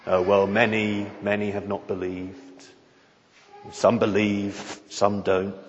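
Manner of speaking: low, soft, shrugging indifference; unconcerned dispassion. Shown as a demonstration. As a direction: toss it off as if it doesn't matter. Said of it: "not sort of voiced in a tone of, of unconcerned dispassion".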